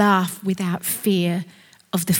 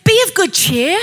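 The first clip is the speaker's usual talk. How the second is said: Jesus calling; 10 semitones higher, 5 dB louder than usual. Acted. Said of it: "Jesus calls him and he says".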